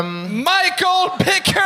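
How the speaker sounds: slow, high, drawn-out host intro; announcer call. Acted. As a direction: call the name out like an emcee. Host announces the next comic.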